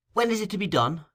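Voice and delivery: Nancy asking. A narrator's reading. Nancy asks about the robbery.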